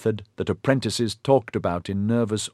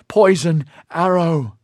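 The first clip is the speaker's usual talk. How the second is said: alarmed shout; 9 semitones higher, 6 dB louder, slower than usual. Acted. Warning the group.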